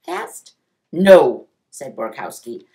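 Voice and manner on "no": loud, firm principal's no; stern and emphatic; stern correction. A narrator's reading. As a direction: shut down the wrong guess loudly. Principal corrects wrong guess.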